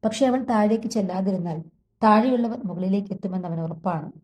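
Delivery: ordinary delivery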